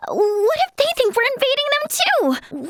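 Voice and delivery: high-pitched, alarmed question; panicky worry. Acted. Alarmed at possible invasion misunderstanding.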